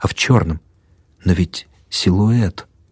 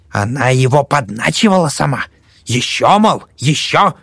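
put-on old woman voice; 6 semitones higher than usual.

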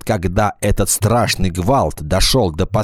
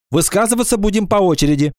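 quick, high-pitched order; commanding; 12 semitones higher, faster than usual. A narrator's reading. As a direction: lay down the rule briskly. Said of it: "скомандовала Ира Сыркина". (быстро, командным тоном)

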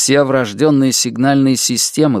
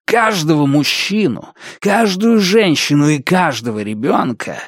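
фанатичный коротышка, угроза; 3 semitones higher than usual. Acted. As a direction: swear revenge like a zealot. (пылкая клятва безумного мстителя)

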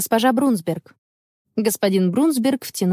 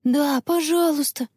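put-on higher, gentle child's plea; pleading request; 7 semitones higher than usual. A narrator's reading. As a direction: ask sweetly, without shouting. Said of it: "попросила Анна". (просительно, высоким голосом)

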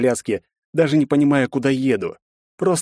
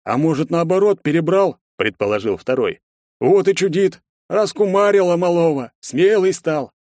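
mocking thug; 7 semitones higher than usual. Second thug mocks hero.

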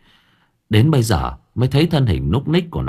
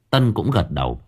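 usual speaking voice

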